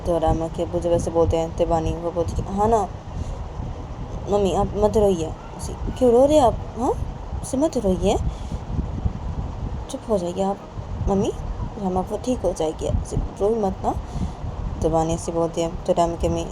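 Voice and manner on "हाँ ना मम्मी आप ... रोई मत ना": daughter consoling. Acted. Daughter consoling crying mother.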